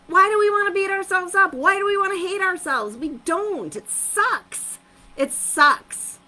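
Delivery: rally them with high energy; high-pitched passionate outburst. Real, not acted. Coach fires up her viewers, high and loud.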